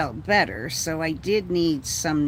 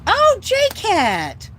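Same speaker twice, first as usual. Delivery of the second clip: much higher, loud delighted call; loud joyful hello; excited greeting; 8 dB louder than usual. Real, not acted. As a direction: call out happily to a friend who just showed up. Greeting friend arriving.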